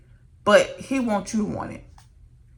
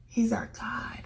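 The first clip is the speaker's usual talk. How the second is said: hushed whisper of awe; 4 semitones higher, 5 dB quieter, slower than usual. Real, not acted. To her followers about God, a reverent whisper. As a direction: breathe it out softly. Whispered close of God's names.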